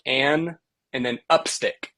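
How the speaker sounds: an, pause, then upstick; syllable breakdown. Shown as a demonstration. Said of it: "So think of, like".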